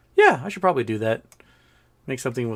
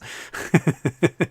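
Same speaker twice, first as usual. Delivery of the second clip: breathy run of chuckles; amused chuckle; 3 semitones higher than usual. Real, not acted. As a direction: chuckle after refusing a silly idea. Laughs at a viewer's comment.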